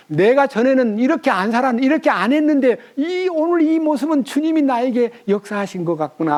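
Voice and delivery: high, marvelling musing; amazed at how you've changed; wondering. Pretend. Believer marvels at changed self. (높은 목소리로 놀라며 깨닫기)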